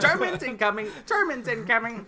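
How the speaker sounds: high, loud mock parrot warning; parrot alarm. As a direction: squawk the warning like a lookout parrot. Acting out parrots warning of aircraft.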